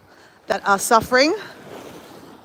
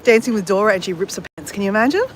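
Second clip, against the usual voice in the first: ordinary talk, nothing marked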